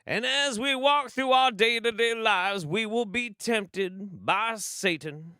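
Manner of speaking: high, loud pastor drawl, slowing into a sermon; mock the coach as a Baptist preacher; evangelical preacher. Shown as a demonstration. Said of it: "a slight drawl in which we're talking".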